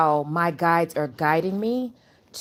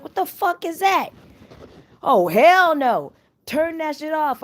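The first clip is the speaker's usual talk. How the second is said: high, loud, outraged outburst; high-pitched, incredulous freak-out; retold alarm; 10 semitones higher, 6 dB louder than usual. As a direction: act out your alarm, voice shooting up. Retelling her alarmed reaction to device.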